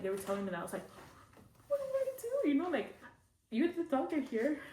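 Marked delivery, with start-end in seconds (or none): 1.7-4.6 high-pitched, louder helpless squeal; mock panic. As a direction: retell a panicked plea, voice shooting way up. Retold panicked plea to the doctor.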